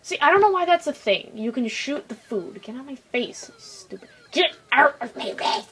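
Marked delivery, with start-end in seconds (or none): 2.6-4.1 soft grumble fading to a whisper; quiet, trailing-off irritation; muttered annoyance. Real, not acted. Muttering at a game enemy. 4.3-5.7 rough, sudden yell at the enemy; exasperated game rage; irritated outburst. Real, not acted.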